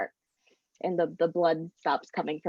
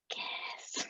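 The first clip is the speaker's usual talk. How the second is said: voiceless ghostly whisper; 15 dB quieter than usual. Acted. Unseen ghost urging two trapped friends to kiss, whispered. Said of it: "they just hear the whispers".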